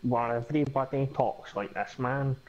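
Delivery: a light Glaswegian accent, nasal as described; lightly put-on Glaswegian twang; a hint of Glasgow slang. Shown as a demonstration. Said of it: "the Glaswegian sort of slang".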